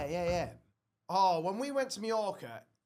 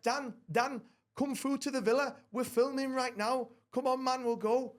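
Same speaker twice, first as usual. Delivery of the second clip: lightly voiced mate, pitched higher and a bit brighter in energy; breezy higher mock of his mate calling him over; 6 semitones higher than usual. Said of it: "[name] speaks like this".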